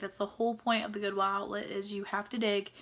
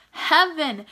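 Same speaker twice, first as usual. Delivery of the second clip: "heaven" said higher and louder with a gushing fall; excited heaven; 6 semitones higher, 8 dB louder than usual. Real, not acted. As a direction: gush the word "heaven" loud and high. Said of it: "it's heaven".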